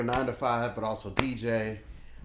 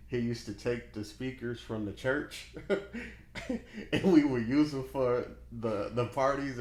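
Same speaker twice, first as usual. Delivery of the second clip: laughing; 4 semitones higher, 3 dB louder than usual. Real, not acted. Laughing while telling a church story.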